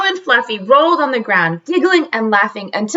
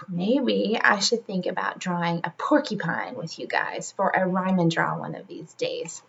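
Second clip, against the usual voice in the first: whisper; 7 semitones lower, 10 dB quieter than usual. Real, not acted. Storyteller muses to her young listeners in a whisper. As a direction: drop to a whisper.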